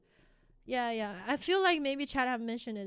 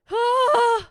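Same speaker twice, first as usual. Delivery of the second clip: loud sigh; 10 semitones higher, 15 dB louder than usual. Real, not acted. Relieved after the scare.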